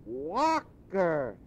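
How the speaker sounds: put-on high, scoffing boy's cry; a boy who thinks he's being teased; incredulous exclaim. Boy not believing Scrooge, exclaiming. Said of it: "exclaimed the boy".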